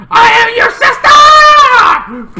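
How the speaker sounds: very high, loud, slow drawn-out shout; dramatic villain reveal. Retold or imitated. Sister unmasking herself to them, slow high shout. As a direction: bellow the reveal with relish.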